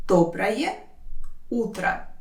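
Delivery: «Доброе», long pause, «утро»; slow teaching breakdown of «Доброе утро»; word by word. Shown as a demonstration. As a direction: sound out each word separately. Teacher separates words for learners.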